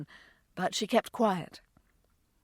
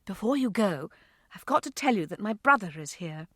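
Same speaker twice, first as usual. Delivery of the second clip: plain reading voice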